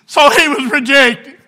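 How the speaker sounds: slow, high, loud pulpit cadence; preaching at full force. Acted. Preacher telling the congregation of the cornerstone, slow booming. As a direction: lean into each phrase like a revival.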